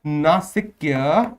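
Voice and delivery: Nasikya stretched very slowly, syllable by syllable; teacher spells out the new term Nasikya slowly for the class. Shown as a demonstration. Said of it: "क्या कहते हैं इसको?".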